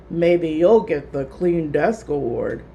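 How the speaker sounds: low, slow teasing voice; eye-rolling sarcasm. A narrator's reading. Lewis mocking Philip's tidy desk, sarcastic. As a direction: roll your eyes as you say it. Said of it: "Lewis rolled his eyes and said".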